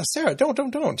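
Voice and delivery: high-pitched, anxious "stop that"; retold urgent warning. Husband warning his wife off the rocks, retold urgent warning. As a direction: retell an urgent plea, pitch jumping up.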